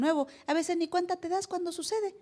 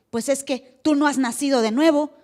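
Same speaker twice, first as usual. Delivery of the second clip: mock scolding; 8 dB louder than usual. Scolding someone not born again.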